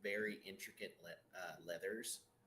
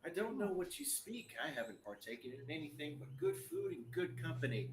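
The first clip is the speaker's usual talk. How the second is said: high, coy voice playing dumb; a tavern keeper pretending she never drank; feigned innocence; 5 semitones higher than usual. NPC Molly acting innocent.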